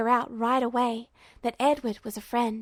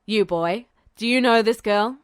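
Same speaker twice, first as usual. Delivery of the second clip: put-on a military man interrogating a boy; stern general; 2 semitones lower, 5 dB louder than usual. General questions Duo, low voice.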